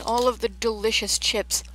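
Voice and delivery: ordinary talk, nothing marked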